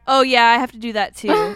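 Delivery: loud, breezy imitation of a student; loud retold student. Student chatting to her through the bathroom, loud imitation. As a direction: call it out like an oblivious student.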